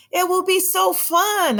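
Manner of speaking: high, slow, sing-song promise; eager coaxing. Acted. Lila promising her cousins camping will be fun, eager. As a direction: coax them along, bright and high.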